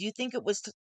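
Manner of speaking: plain reading voice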